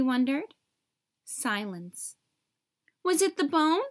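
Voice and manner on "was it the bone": calling out. A narrator's reading. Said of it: "he called to the bird".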